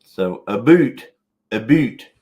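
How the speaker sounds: mock Canadian accent. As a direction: imitate Canadian "about" as a drawn-out "a boot". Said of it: "talk about our Canadian accent".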